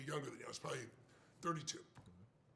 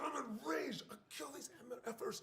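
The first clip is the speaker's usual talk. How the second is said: mock enraged partner; 7 semitones higher than usual. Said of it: "goes apeshit".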